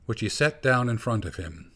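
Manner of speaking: ordinary delivery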